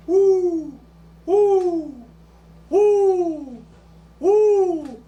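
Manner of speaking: high loud whoops; whooping. Shown as a demonstration. Comic showing off a tic, whooping. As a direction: let out whoop after whoop.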